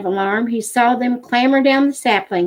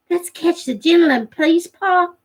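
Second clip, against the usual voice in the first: put-on higher, wheedling boy voice; pleading boy; 8 semitones higher than usual. Jody pleads with Pa for the gentle cub, high and eager. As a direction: coax Pa like an eager child.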